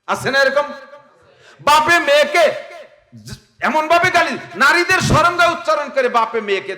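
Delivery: high, loud sermon cry; full-force preaching. Acted. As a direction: raise the voice high to stir the crowd. (উচ্চ স্বরে জোরালো ওয়াজ)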